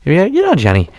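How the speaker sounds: lightly voiced Irish lilt; retelling a coworker's line in an Irish accent. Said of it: "forgive my Irish accent".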